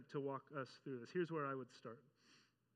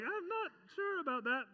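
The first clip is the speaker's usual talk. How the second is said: doubtful; 11 semitones higher, 4 dB louder than usual. Retold or imitated. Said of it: "And you're like".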